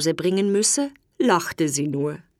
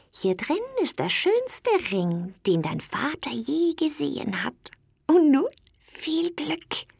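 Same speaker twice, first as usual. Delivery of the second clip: put-on a secretive, whispery old toad, kindly; old toad whispering; 7 semitones higher, 4 dB quieter than usual. A narrator's reading. Old toad hands Dummerchen the magic box, whispering. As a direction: hush it like a wise old toad sharing a secret.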